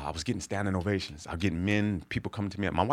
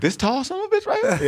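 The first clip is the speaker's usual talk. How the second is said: loud, much higher, incredulous yell at the wife; mock outraged shout; 5 dB louder than usual. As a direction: shout it in disbelief, pointing at the tall son. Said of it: "I'm like".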